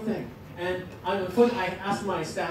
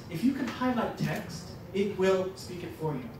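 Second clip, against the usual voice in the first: ordinary talk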